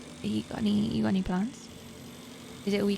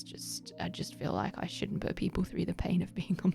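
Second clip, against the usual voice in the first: whispered; 6 dB quieter than usual. Real, not acted. Embarrassed confession about bad gaming.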